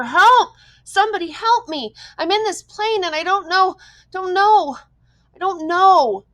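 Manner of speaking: screamed high and very loud, desperate; panicked screaming. A narrator's reading. Boy screams into the radio for help, panicked and loud. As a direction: shriek for help like you're falling. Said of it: "he began to scream into the microphone".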